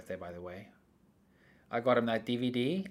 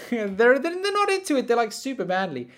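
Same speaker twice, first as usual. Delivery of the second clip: ordinary delivery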